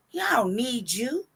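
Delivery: sing-song mock bravado, slowing on the repeat; hot boy talk. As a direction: swagger through it, close to laughing. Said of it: "been talking that hot boy shit".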